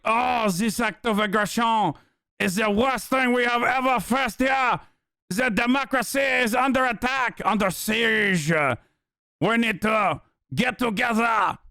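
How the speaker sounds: high, melodramatic fake French accent; mock French accent. Mocking French politicians alarmed about democracy, hammed-up French accent. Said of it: "And these guys are like".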